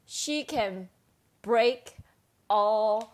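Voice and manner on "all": usual speaking voice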